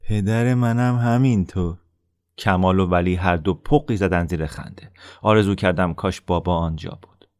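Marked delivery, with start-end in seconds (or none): 0.0-1.8 put-on slow, whining parody of the boy; bully mocking the boy's whine; whiny mocking imitation. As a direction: mimic him in a whiny voice. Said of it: "آصف با صدای نالانی ادایم را درآورد".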